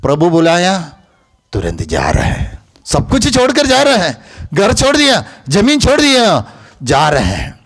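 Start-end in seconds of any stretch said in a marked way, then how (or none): 2.8-6.5 high-pitched, insistent preaching; high, ringing exhortation; preaching at full force. Real, not acted. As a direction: drive each point home to the congregation in a raised pitch.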